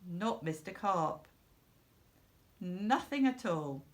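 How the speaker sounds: ordinary delivery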